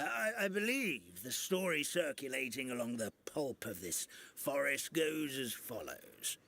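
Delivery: normal talking voice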